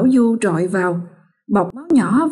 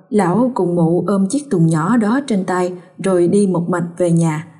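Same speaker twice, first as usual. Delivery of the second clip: ordinary delivery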